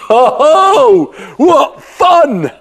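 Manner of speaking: high laughing delight; gleeful laugh. Acted. Simon thrilled to try magic, laughing. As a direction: laugh out with glee.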